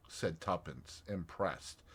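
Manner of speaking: plain reading voice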